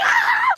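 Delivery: high sharp scream of pain. Retold or imitated. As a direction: shriek as the wax rips. Ripped wax off, she screams.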